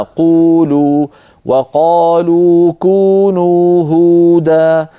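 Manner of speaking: قولوا slow and loud, lips rounded; recited examples. Shown as a demonstration. Teacher recites verse examples, slow and loud. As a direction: chant قولوا and كونوا هودا slowly. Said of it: "إذا نطقنا قلنا".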